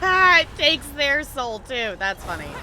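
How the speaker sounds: usual speaking voice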